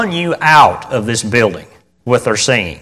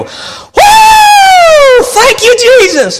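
very high loud whoop of praise; joyful shout; 6 dB louder, much slower than usual. Retold or imitated. Preacher imitating Shirley's praise whoop, high shout. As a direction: holler it out like a war whoop. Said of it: "she'd go".

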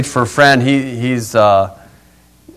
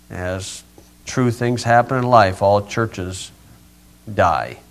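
gentle pastoral prayer; closing prayer; 2 semitones lower, 5 dB quieter than usual. Real, not acted. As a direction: pray quietly to the Lord.

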